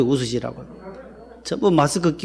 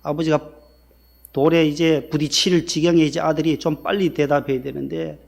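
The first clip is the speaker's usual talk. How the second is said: usual speaking voice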